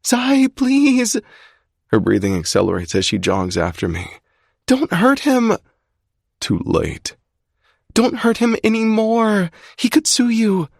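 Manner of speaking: high panicked cry. A narrator's reading. Alex panicking as Zai drags Duncan, high panicked cry. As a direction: blurt it out in panic.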